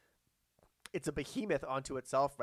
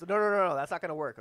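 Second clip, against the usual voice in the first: louder, higher rapid-fire refusal; dismissive boss; 4 semitones higher, 6 dB louder than usual. Acted. Studio boss cutting off a writer's pitch, loudly dismissive. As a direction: shoot the pitch down hard.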